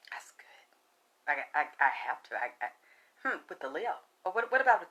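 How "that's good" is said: hushed satisfied whisper. Real, not acted. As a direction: whisper it softly. Whispered after drinking.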